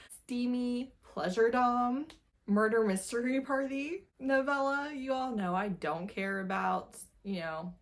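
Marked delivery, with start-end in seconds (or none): none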